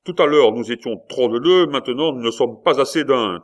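a gentleman berating the innkeeper; indignant scolding. A narrator's reading. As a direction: throw the insult back at him. Coconas scolds insolent innkeeper.

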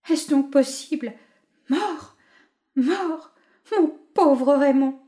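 high, loud disbelieving lament; stunned grief. A narrator's reading. Widow at the bedside, high grieving lament. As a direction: repeat it as if it can't be true. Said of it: "répétait madame Deblin".

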